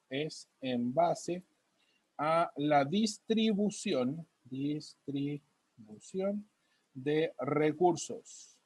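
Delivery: spelling it out word by word, very slow; teacher dictating notes to the class, slow and drawn out; slow dictation. Real, not acted. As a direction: dictate slowly as you write it on the board. Teacher dictating while writing on board.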